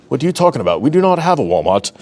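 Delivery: put-on a light, self-admittedly poor British accent; mock British accent. Host voicing a British viewer who has no Walmart, in a mild British accent. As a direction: play a Brit scoffing at the word Walmart, in a hint of a British accent. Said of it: "it's my best U K eth-, accent going".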